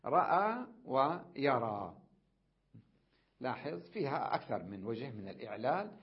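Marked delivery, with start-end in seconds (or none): 0.1-2.0 رأى ويرى spelled out slowly and loudly; slow demonstration. Shown as a demonstration. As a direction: draw out رأى ويرى for the class. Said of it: "أحذف الهمزة تصبح". (نطق رأى ويرى ببطء ووضوح)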